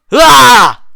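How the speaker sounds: sharp, high, loud startled "ah"; sudden scream. Acted. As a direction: yelp it out of nowhere. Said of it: "Don't scream so suddenly".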